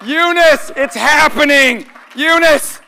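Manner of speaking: loud, high excited call. Real, not acted. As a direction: call her name across the room. Calling out to a friend.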